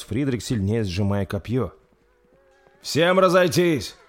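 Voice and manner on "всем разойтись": slow, loud, high battle orders; commanding. Acted. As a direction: call the ranks apart. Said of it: "скомандовал принц".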